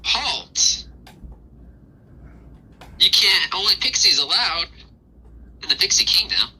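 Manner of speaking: high, sharp little pixie guard. Acted. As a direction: bark it like a tiny gatekeeper. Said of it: "the pixie stops you, and he's like".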